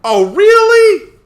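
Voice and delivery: high, slow, loud reaction; loud disbelief. Real, not acted. Watching the new execution, loud high disbelief. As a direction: stretch it out way up high.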